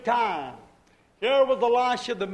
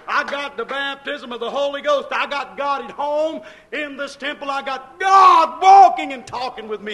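surging sermon with shouted peaks; preacher bursting into shouts on key phrases; fired-up preaching; 4 semitones higher, 9 dB louder than usual. Real, not acted. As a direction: testify loudly to the congregation. Preaches at full force.

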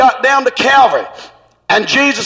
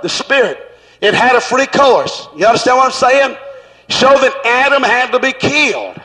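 ordinary delivery

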